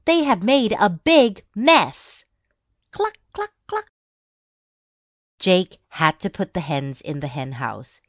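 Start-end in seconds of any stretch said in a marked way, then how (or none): 0.0-2.2 high, loud complaint; shouting mom. A narrator's reading. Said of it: "she shouted". 2.9-3.9 very high, short hen clucks; clucking hen. A narrator's reading. Narrator voices the hens clucking.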